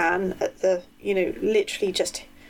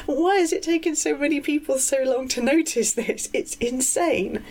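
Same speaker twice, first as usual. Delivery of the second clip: incredulous laugh; 6 semitones higher than usual. Real, not acted. Incredulous outburst about the person.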